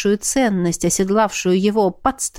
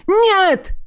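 anguished shout; 5 semitones higher than usual. A narrator's reading. Witnessing the dragon burn.

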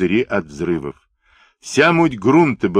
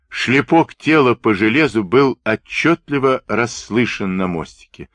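usual speaking voice

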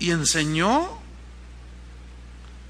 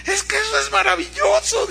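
a much higher, lilting sing-song woman's voice; sing-song lady; 14 semitones higher than usual. Retold or imitated. Preacher imitating a newcomer who would accept a Grammy, high sing-song. Woman answers in church meeting.

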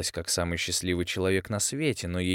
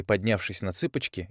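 ordinary delivery

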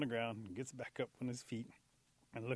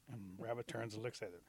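usual speaking voice

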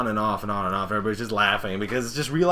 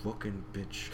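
muttered retold insult; 3 semitones lower, 15 dB quieter than usual. Rainbow Dash reacting to Gilda's boast, muttered. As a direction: curse quietly under your breath. Retelling Rainbow Dash's private resentment.

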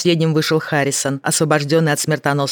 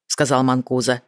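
usual speaking voice

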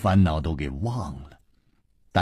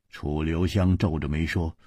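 plain reading voice

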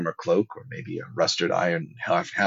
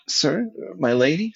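much higher-pitched questioning voice; Podrick; 10 semitones higher than usual. A narrator's reading. Podrick addressing his lord and lady, high questioning voice. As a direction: lift it high for a squire's question.

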